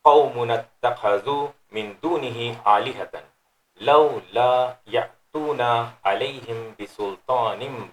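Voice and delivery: measured, chanted Quranic recitation. Acted. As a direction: recite the verse with tajweed. Recites the verse in Arabic.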